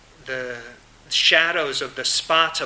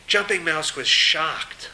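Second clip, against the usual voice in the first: usual speaking voice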